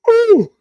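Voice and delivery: high, loud yawn. Real, not acted. Streamer interrupted while reading an article, loud high yawn. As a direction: let out a big high-pitched yawn. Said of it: "I keep yawning".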